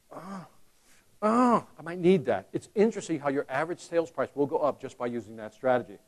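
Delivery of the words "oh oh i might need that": slow, soft second thoughts; hesitant customer. Retold or imitated. Customer reconsidering removing options, slow hesitant. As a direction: hedge slowly over each item. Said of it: "What do you think they do".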